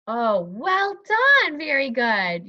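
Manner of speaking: loud, drawn-out teacher praise, pitched high; enthusiastic praise. Pretend. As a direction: praise a pupil with big, slow delight. Teacher praising the pupil warmly.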